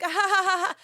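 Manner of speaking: loud, high mock "ha ha ha"; hammed-up staccato laughter; acted laugh. Woman telling friends about a crude remark, high mock laugh.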